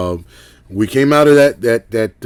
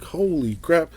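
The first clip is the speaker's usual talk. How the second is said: stunned realisation; 7 semitones higher, 6 dB quieter than usual. Retold or imitated. Realising something unknown was behind him.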